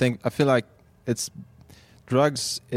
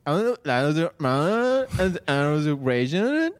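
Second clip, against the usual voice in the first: slow, drawled LA voice; LA accent; 3 semitones higher, much slower than usual. Retold or imitated. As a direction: drawl lazily like an LA person. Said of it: "Like an L A person is".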